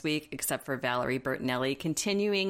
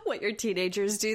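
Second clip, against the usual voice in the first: ordinary talk, nothing marked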